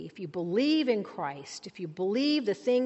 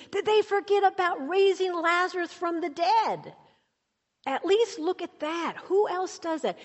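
full-force preaching; 6 semitones higher than usual. Acted. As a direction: preach it out, rising hard to the top. Preacher rising to a climax.

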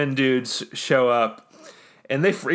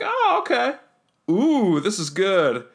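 much higher, squeaky delight at the drink; 6 semitones higher than usual. Acted. Exegol tastes the secretary's drink, high and delighted. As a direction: sip and squeal how good it is.

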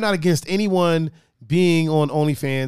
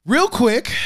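usual speaking voice

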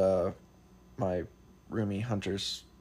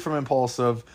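ordinary talk

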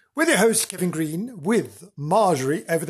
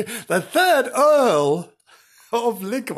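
"the third Earl" stretched slow and high, grand; grand title; 7 semitones higher, 4 dB louder, slower than usual. Real, not acted. Host retrying the earl's title, high and slow. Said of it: "Why can't I say that?".

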